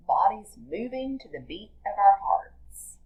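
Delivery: ordinary delivery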